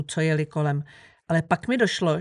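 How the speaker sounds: ordinary delivery, nothing marked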